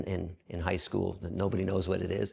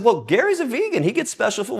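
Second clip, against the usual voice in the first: high mock warden; 13 semitones higher, 8 dB louder than usual. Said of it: "the warden said".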